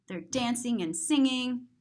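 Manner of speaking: plain reading voice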